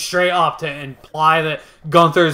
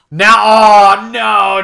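mock dismay; 5 semitones higher, 9 dB louder, much slower than usual. Narrating a game character's reaction.

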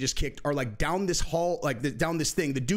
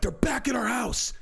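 urgent alarm; 8 semitones higher than usual. Retold or imitated. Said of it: "He's like".

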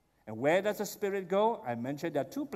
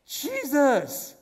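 sudden excited cry of recognition; excited exclamation; 8 semitones higher, 6 dB louder than usual. Acted. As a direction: gasp out the name in amazement. Disciples recognizing Jesus, excited.

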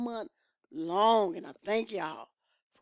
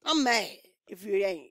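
ordinary delivery

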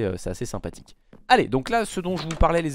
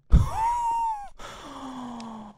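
high screaming laugh of relief; hysterical shrieking laugh. Real, not acted. Release after the scare.

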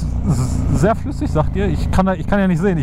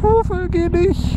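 sing-song dog call; 12 semitones higher, much slower than usual. Real, not acted. Calling after a dog.

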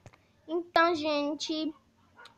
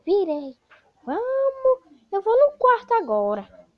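high, loud villain grabbing knives; 8 semitones higher, 10 dB louder, slower than usual. Acted. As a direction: squeal like a frantic monster. Villain heads to the room.

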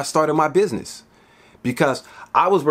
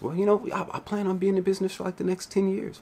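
quiet customer; 4 semitones higher, 8 dB quieter than usual. Retold or imitated. Customer answering, voiced quietly.

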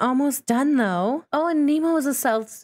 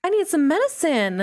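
high, dramatic gasp then a woozy complaint; dizzy sick sim; 8 semitones higher than usual. Acted. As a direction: play the sim who suddenly feels dizzy and sick.

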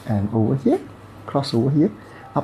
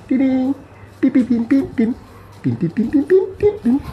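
high, louder toy-machine noises; playful dings and beeps; 13 semitones higher, 4 dB louder than usual. Pretend. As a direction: ring and beep in a squeaky high pitch. Imitated dings and beeps during treatment.

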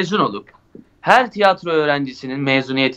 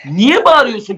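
startled question; 7 semitones higher, 4 dB louder than usual. Retold or imitated.

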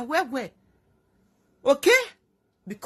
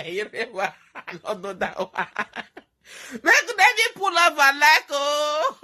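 laughing; 3 semitones higher than usual. Real, not acted. Laughing at the comments.

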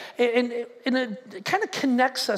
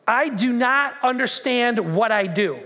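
slow, word-by-word reading; deliberate scripture reading; slower than usual. Acted. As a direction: weigh each word of the verse. Measured reading of Paul's words.

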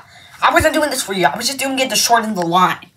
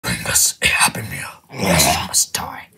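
menacing whisper; 5 dB quieter than usual. Acted. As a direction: hiss the threat like a dark creature. Villain threatens for Happy Meal.

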